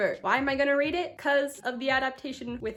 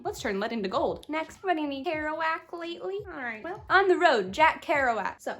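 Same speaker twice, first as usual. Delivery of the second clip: usual speaking voice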